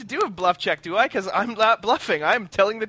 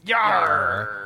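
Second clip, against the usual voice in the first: pirate yar; 3 semitones lower than usual. Acted. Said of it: "We talk like a pirate".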